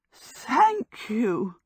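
a touch out of breath; breathless cry. A narrator's reading. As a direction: gasp out thanks in relief. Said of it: "Sophie cried breathlessly".

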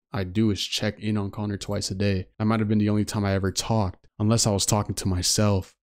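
low, even storytelling voice; story narration. A narrator's reading. Player reads the ranger's story to viewers, story narration.

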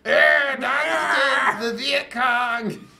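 high, drawn-out bellow; slow, full-voiced hammed-up yell; mock war cry. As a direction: scream it like a crazed battle cry.

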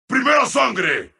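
brighter, lifted announcer call; rousing announcer call. Acted. Announcer declaring first blood, rousingly. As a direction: announce it with rising energy.

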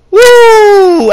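high shrill signature wrestler woo, loud; loud wrestling woo yell; Ric Flair woo. Retold or imitated. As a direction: belt it out like the Nature Boy. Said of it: "I'm sorry if that hurts your ears again".

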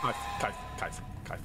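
urgent hushed whisper. Real, not acted. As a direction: whisper so it can't hear. Hiding from monster, whispering.

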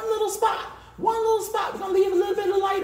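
a high cartoonish little voice; squeaky voice. Real, not acted. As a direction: pip it out in a tiny high voice. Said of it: "squeaky high playful voice".

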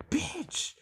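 sudden high yelp of fright; startled yelp. Real, not acted. Player jump-scared by monster, high startled yelp. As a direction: jump out of your skin on it.

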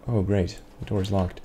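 usual speaking voice

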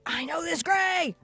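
high, loud delighted cry; squealing I know this; excited recognition. Retold or imitated. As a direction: shout it like a thrilled student. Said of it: "you're like".

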